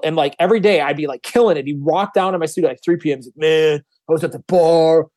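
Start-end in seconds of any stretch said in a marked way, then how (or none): 3.3-5.1 slow, rambling party-singer voice; lazy singer. Retold or imitated. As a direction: drag it out like a hungover singer. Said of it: "a singer that was one of these people".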